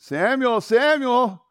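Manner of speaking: high, loud drawn call. Acted. God calls the sleeping boy at night, calling loudly. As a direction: call to wake a sleeper from afar.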